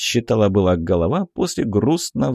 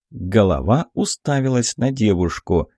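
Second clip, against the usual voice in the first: usual speaking voice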